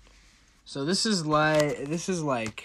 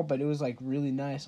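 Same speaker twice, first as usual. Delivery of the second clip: ordinary delivery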